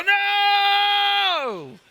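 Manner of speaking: high, loud disbelieving yell; sports shout. Retold or imitated. Pastor acting a fan's cry at a game, loud and high. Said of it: "and I go".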